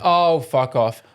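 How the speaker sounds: mock startled slow groan; slow drawn-out oh fuck off. Retelling a surprise greeting, a drawn-out oh fuck off. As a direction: stretch the oh, drop it slowly. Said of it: "and I went".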